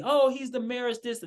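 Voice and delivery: normal talking voice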